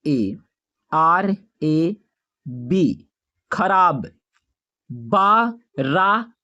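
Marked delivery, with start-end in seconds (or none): 4.9-6.3 बारात stretched very slowly; drawn out. Shown as a demonstration. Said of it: "teacher says the word slowly". (बारात को धीरे खींचकर बोलें)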